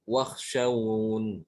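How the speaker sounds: wakhshawun drawn out, closing on n; very slow, falling wakhshawun with no i; stopped without ya. Shown as a demonstration. Teacher showing the pause form, drawn out. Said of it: "Kalau berhenti".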